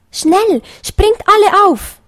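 rally everyone aboard at once; bunny calling the foxes to jump in, urgently. A narrator's reading. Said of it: "rief Kleinöhrchen".

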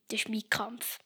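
hushed, secretive whisper; whispered punchline. Acted. Boy whispering the punchline to his mother.

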